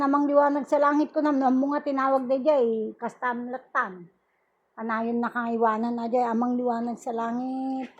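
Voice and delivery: healer's hushed invocation over patient; murmured prayer. Acted. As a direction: pray quickly and quietly to yourself. Murmured prayer during healing.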